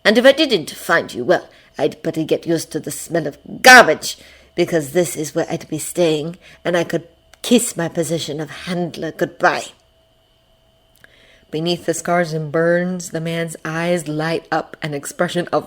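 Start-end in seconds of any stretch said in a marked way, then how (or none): 0.0-9.7 lightly voiced menacing handler; savoring every word of a villain's speech; gloating villain. A narrator's reading. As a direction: relish it like a smug captor.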